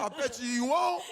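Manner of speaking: laughing mock taunt. Guy daring friend off cliff, loud laughing taunt. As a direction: taunt him loudly through a laugh.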